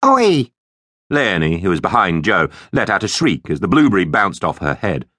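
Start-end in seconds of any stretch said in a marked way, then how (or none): 0.0-0.5 high, loud yell of protest; indignant shout. Acted. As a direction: call out sharply at whoever threw it. Berry fired at the speaker.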